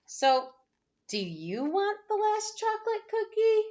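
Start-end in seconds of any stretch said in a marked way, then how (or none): none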